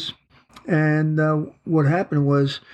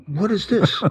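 surprised; 6 semitones higher than usual. Retold or imitated. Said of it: "and I said".

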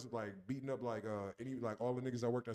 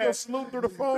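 loud teasing; 10 semitones higher, 9 dB louder than usual. Real, not acted. Mocking laughing remark.

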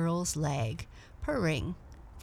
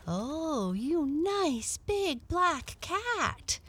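put-on a light, slow, high little girl voice; delighted little girl; 10 semitones higher, much slower than usual. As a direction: coo at the cat. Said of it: "exclaimed the little girl".

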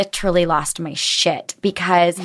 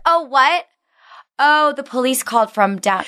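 mock flustered; 6 semitones higher than usual. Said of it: "I was like".

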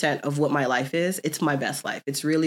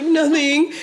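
higher, louder sulky "Nothing."; pouty denial; 10 semitones higher, 5 dB louder than usual. Retold or imitated. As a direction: sulk and deny it. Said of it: "I was like".

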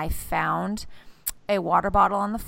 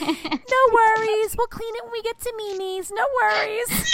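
put-on chipper kid. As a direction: reassure sweetly like a brave little kid. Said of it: "He goes".

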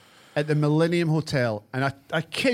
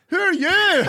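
put-on high, loud confused drunk; 11 semitones higher, 5 dB louder, slower than usual. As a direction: laugh through a drunk's confused question. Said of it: "And you went".

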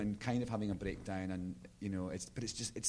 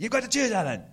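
dramatic demand; 14 semitones higher, 9 dB louder than usual. Retold or imitated. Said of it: "someone saying".

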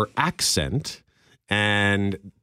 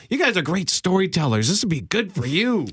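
a higher, sing-song imitation of the boss; mock boss flattery; 6 semitones higher than usual. Co-host imitating bosses buttering up staff. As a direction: flatter them like a scheming boss. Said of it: "Our bosses like to say".

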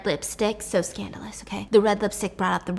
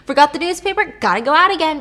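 1 mock casual errand excuse; 6 semitones higher, 5 dB louder than usual. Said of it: "she's like".

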